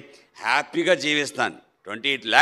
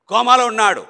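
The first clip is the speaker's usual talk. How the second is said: usual speaking voice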